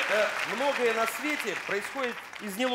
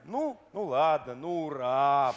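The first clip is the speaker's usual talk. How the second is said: half-hearted cheer; 6 semitones lower, slower than usual. Retold or imitated. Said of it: "бежишь ты как-то неискренне".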